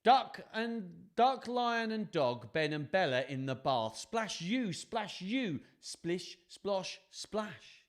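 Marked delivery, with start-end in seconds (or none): 0.0-3.2 quick chanting list with high splashes; lively picture-book narration. A narrator's reading. Listing the bathers for children, bouncy rhythmic reading. 4.0-7.9 quick chanting list with high splashes; lively picture-book narration. A narrator's reading. Listing the bathers for children, bouncy rhythmic reading.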